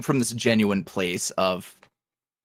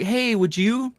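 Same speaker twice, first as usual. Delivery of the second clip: imitating fans asking; 9 semitones higher than usual. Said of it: "people would ask".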